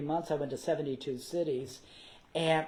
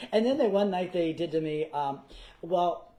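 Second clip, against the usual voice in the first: usual speaking voice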